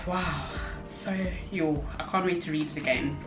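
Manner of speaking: usual speaking voice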